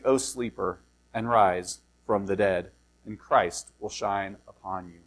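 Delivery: low, soft, measured reading of the Bible passage; scripture reading. Acted. Pastor reading Ephesians to the congregation, soft and measured. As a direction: read the verses calmly and reverently.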